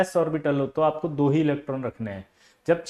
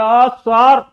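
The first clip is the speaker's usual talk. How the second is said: 1 lazy student; 7 semitones higher, 11 dB louder, much slower than usual. Retold or imitated. Lazy student voiced in story.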